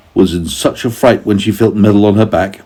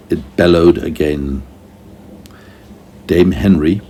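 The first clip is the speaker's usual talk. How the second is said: usual speaking voice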